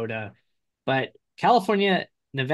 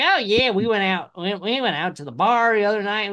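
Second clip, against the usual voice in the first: mock twang, a bit higher; imitating a southern twang; 6 semitones higher, 3 dB louder than usual. Mimicking brother on the phone, a light mock southern twang. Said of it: "where did that twang come from".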